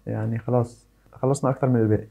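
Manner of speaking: ordinary delivery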